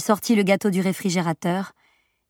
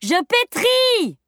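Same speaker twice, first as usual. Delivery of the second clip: very high, drawn-out yell; cried out; much slower than usual. A narrator's reading. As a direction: yell back slowly over clattering pans. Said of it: "Cria la mère au milieu d'un bruit de casserole". (cri très aigu et traînant de la mère)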